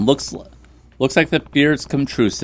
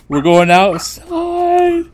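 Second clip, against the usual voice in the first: much higher, very slow sing-song dog talk; baby talk to dog; 5 dB louder, much slower than usual. Pretend. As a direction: coo at the puppy like a pet owner. Talking sweetly to the dog.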